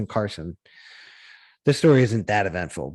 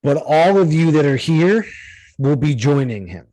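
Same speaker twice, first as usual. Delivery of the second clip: louder, raised announcement to competitors; lightly acted announcer; 3 semitones higher, 5 dB louder than usual. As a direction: lightly imitate an organizer addressing the whole group.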